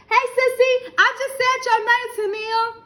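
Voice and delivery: high, loud happy shout-out; light up greeting a friend; excited greeting. Real, not acted.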